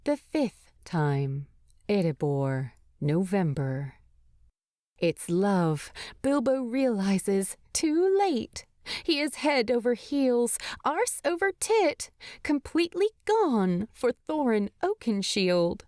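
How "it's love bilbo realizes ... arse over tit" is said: ordinary delivery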